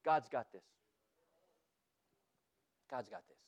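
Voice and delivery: a near-whispered fading assurance; hushed. Real, not acted. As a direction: let it sink in with a hush. Hushed repetition for emphasis.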